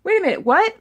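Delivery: incredulous. Retold or imitated. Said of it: "And I'm like".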